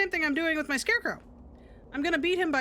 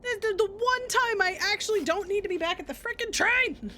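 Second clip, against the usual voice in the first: frustrated shout; 7 semitones higher than usual. Real, not acted. Gamer sent to baseball again, real frustrated shout. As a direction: yell it at the game in real annoyance. Gamer rages at wrong destination.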